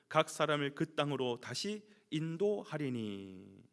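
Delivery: low, loud, measured recitation; scripture reading. Acted. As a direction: proclaim the verse in a steady low tone. Reads the Bible passage aloud. (낮고 힘 있게 성경 봉독)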